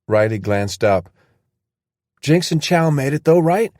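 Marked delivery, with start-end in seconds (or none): 2.2-3.7 hopeful. Acted. Riley hopeful question.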